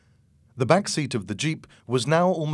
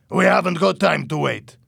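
loud, raised, urgent retort; shouting back; 7 semitones higher, 8 dB louder than usual. A narrator's reading. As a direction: yell back impatiently. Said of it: "Louis shouted back".

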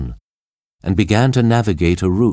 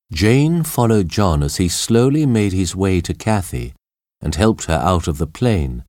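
usual speaking voice

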